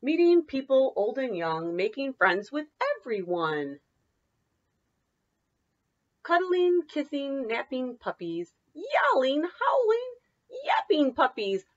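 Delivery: lively singsong picture-book read; bouncy read-aloud for children. Acted. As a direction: read it like story time for little kids. Teacher reads picture book aloud.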